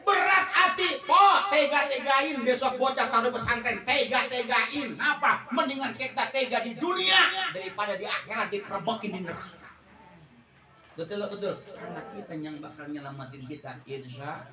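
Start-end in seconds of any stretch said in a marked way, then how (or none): 0.0-9.5 loud, high preaching, slowing on tega and nangis; fiery preaching. Acted. Preacher urging parents to harden their hearts, full sermon force. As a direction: drive it home like a preacher.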